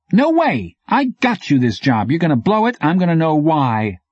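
high-pitched, pushy character voice; demanding street courier, pitched well up; confrontational bike courier. A narrator's reading. Sammy confronts Chevette in the story.

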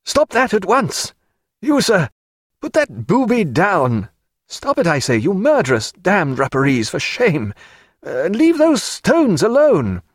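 high, indignant scolding; strong outraged call. A narrator's reading. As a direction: call out sternly to stop them at once. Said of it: "called out in a strong voice".

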